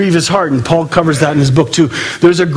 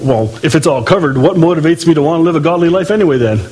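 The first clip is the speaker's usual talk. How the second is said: usual speaking voice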